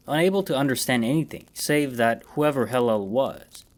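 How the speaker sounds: ordinary delivery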